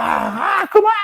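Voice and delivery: mock child yelling. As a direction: yell like a kid strapped in. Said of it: "정신병자 마냥". (안전벨트에 묶인 아들 흉내, 소리치며)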